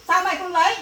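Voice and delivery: mother demanding her daughter explain herself; angry demand. Acted. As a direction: push her to answer. Mother demands why daughter refuses.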